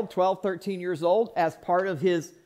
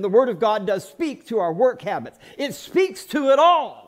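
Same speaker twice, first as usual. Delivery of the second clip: impassioned preaching; 4 semitones higher, 5 dB louder than usual. Real, not acted. Pastor telling the congregation the Word speaks to everything, impassioned preaching. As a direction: preach it harder with each repetition. Preaches a building repeated refrain.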